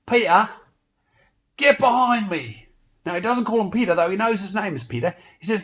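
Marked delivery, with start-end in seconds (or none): 0.0-2.7 sharp, loud and higher rebuke; riled up. Acted. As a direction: snap at him to get back. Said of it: "he gets pretty riled up".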